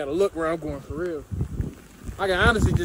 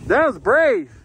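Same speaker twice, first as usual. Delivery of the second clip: loud cheer, pitched up; excited praise; 6 semitones higher, 9 dB louder, slower than usual. Real, not acted. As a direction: call it out proudly. Cheering a friend's run.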